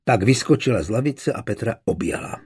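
usual speaking voice

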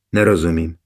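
ordinary delivery

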